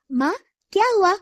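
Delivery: put-on a curious child asking her mother; light child voice, quick and high; young daughter.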